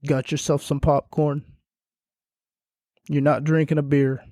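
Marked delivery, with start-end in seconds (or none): none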